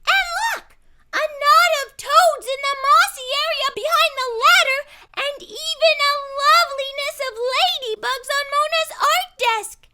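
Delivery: put-on high, loud, delighted girl; excited. A narrator's reading. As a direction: burst out, eyes wide. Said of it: "her eyes big with excitement".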